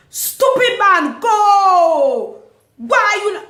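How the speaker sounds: high, loud, drawn-out mock yell; imitated furious yell. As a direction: shriek it like the raging driver. Driver yelling at car ahead.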